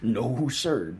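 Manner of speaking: normal talking voice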